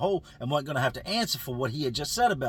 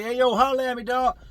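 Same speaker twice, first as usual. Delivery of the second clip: higher, louder, challenging "Hey, yo, holler at me, dog"; aggressive callout; 9 semitones higher, 6 dB louder than usual. Retold or imitated. Inmate calling a rival out to fight, loud and aggressive. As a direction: call him out like it's business. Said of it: "When you look at somebody aggressive".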